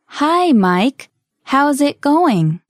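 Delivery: slow, clearly spaced dialogue reading; slowly. Shown as a demonstration. Greeting Mike in a practice conversation, slowly. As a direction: greet at a learner's pace. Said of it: "one more time slowly".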